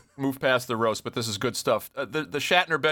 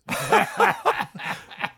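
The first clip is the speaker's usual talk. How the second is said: high breathy burst of laughter. Real, not acted. Host cracking up at the leprechaun bit, high unvoiced laugh.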